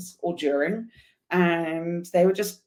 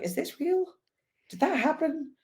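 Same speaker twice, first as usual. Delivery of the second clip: retold disbelief; 10 semitones higher than usual. Rider asking herself about a surreal morning, retold disbelief. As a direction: voice her own disbelief, pitched well up. Retells her disbelief while riding away.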